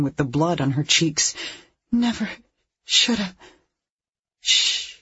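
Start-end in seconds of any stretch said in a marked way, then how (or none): 1.9-3.4 high, halting tearful regret. A narrator's reading. Crying Heidi confessing to Sarah, tearful. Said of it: "She was crying". 4.4-5.0 hushing. A narrator's reading. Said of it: "Sarah said".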